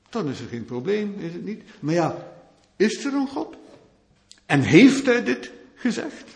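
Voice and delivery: normal talking voice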